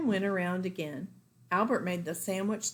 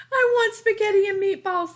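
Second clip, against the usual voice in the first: put-on much higher, louder child's plea; pleading child; 12 semitones higher, 10 dB louder than usual. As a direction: beg like a little girl who's had enough jam.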